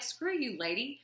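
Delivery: imitating her own huffy protest, high and fast; replaying her indignant rant at the therapist, pitched high; indignant outburst. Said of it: "I was like".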